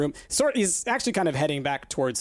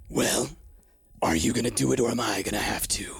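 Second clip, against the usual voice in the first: menacing whisper; 6 semitones lower than usual. Acted. Claw's menacing line to Hex.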